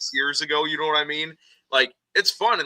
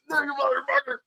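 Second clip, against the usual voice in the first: mock anguish; 11 semitones higher than usual. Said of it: "and, like".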